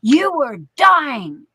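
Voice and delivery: exasperated scolding, dragging out you were dying; husband's angry outburst. Retold or imitated. Husband yelling at her for rushing back to work, angry. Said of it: "And he goes".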